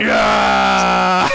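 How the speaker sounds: loud excited yell; excited shout. Real, not acted. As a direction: yell it out in triumph. Throwing guard off ledge, cheering.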